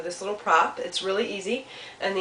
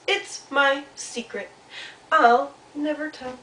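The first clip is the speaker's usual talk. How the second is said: higher, slow, emphatic mouse voice; secretive little Mouse; 6 semitones higher, slower than usual. Acted. Mouse answers Cardinal, slow and firmly secretive. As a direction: refuse firmly, spacing out each word.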